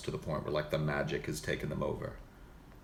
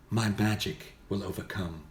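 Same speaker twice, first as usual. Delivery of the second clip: plain mage, gentler than an archmage; soft-spoken mage. Shown as a demonstration. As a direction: declare your magic in restrained wizardly tones. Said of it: "a mage might be more soft-spoken".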